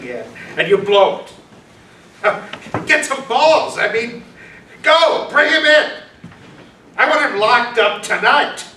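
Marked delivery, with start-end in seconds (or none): 2.9-8.6 berating order. Acted. Police chief ordering Schmidt to go and bring Darger in, angry. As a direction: push him out the door.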